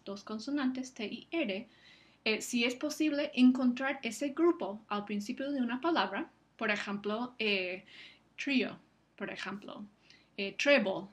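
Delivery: normal talking voice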